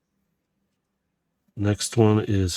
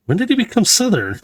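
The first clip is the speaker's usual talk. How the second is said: baffled high question; 11 semitones higher, 3 dB louder than usual. Real, not acted. To the viewers, amused that Megatron is Southern: baffled high question. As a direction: pitch it up high in disbelief on "he".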